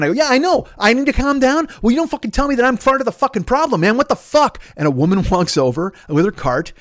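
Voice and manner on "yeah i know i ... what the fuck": high, heated comeback; retold angry outburst. Snapping back at his brother who said calm down, angry. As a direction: refuse to calm down, voice pitched way up. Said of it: "you need to calm down".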